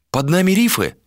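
high female character question; questioning woman. A narrator's reading. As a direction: lift the pitch to ask as her.

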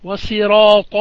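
الصراط said as sirat with a plain s, slow. Shown as a demonstration. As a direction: swap the emphatic sad for a light sin in الصراط. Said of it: "بالسين فيهما".

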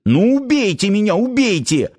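high, daring cry; acted reckless defiance; defiant yell. A narrator's reading. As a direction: dare them in a high-pitched outburst. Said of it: "заорал парень на командиров".

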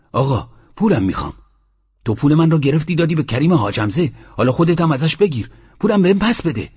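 heated demand, pitch climbing to the end; indignant, insisting on repayment; retold angry demand. Mohammad demands his money back from the sheikh, heated. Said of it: "خون تو سرم دوید".

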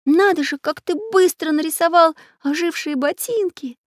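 put-on high, amazed boy voice; surprised. A narrator's reading. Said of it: "удивился Прутик".

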